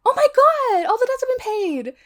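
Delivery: high squealing mock delight; gushing relief. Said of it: "she's meant to be like".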